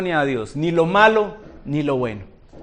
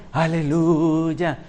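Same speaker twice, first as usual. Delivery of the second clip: drawn-out, lower, softer churchy Aleluya; mock the brother who prays in a put-on holy voice; pompous prayer voice; 5 semitones lower, 4 dB quieter, much slower than usual. Mocking the old-style praying brother, stretched out slow. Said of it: "Muchos cambian la voz cuando oran".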